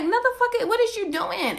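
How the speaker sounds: high, stunned disbelief; mock shocked question; incredulous. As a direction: ask it like you can't believe your eyes. Looking down at him, confused.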